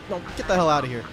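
ordinary talk, nothing marked

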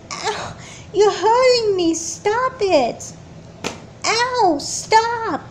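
high, slow, hurt pleading; pained pleading. Acted. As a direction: beg her to stop. Being hurt by sister.

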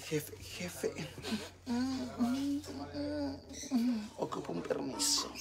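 breathy whispered plea to the boss; whispering to the boss. Retold or imitated. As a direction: whisper it like sneaking permission from the boss. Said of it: "Le voy a decir".

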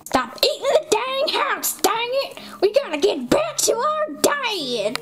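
shrill bossy sister scold; high scolding. Acted. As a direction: nag like a big sister. Gretel scolding brother.

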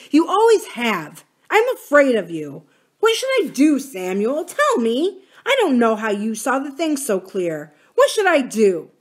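loud, high desperate plea; fierce pleading. A narrator's reading. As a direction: beg him fiercely for an answer. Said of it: "he said fiercely".

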